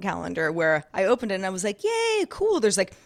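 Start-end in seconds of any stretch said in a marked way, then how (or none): 1.8-2.6 high-pitched little yay; gleeful cheer. Retold or imitated. Host retelling opening a coffee gift calendar, gleeful.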